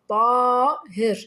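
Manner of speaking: "طاهر" slow and loud, the "طا" held long; stretched alif. Shown as a demonstration. Teacher showing pupils madd al-alif, stretched alif. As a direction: punch and stretch the first syllable of "طاهر". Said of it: "جاء حرف الطاء بمد الألف".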